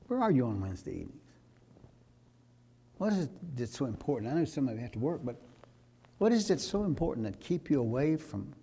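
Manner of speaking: normal talking voice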